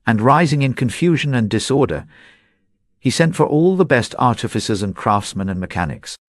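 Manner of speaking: ordinary delivery, nothing marked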